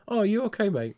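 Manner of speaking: lightly voiced concerned customer; high caring customer voice. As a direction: ask sweetly and worried.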